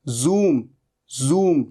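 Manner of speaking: जूम with a clear buzzing z; teacher correcting the word; z sound. Shown as a demonstration. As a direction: model जूम as zoom. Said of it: "ज नहीं".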